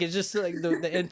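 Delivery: usual speaking voice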